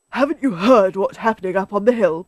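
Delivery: lightly agitated character voice; excited. A narrator's reading. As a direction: blurt out the big news. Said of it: "Badger said excitedly".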